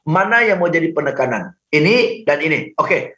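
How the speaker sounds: normal talking voice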